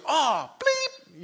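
a pilot jolted by the lightning bolt; startled curse. Retold or imitated. Pilot seeing lightning, yelping a startled curse. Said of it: "and he goes".

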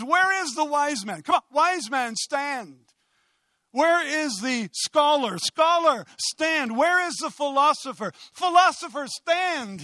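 high, commanding call to stand; ringing challenge. Acted. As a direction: summon each opponent like a preacher calling them out. Acts out Paul challenging the wise.

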